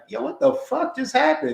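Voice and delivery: high, baffled mock reaction; confused outburst. Retelling her partner's confused reaction. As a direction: play the stunned boyfriend.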